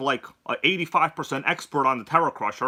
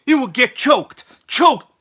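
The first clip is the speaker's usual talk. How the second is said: "choked" said twice, the second louder and higher and falling; stress on choked; 8 semitones higher, 10 dB louder, slower than usual. Acted. Host hyping up his positive energy, stressing "choked". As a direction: punch "choked" harder the second time. Hype host hammering a word.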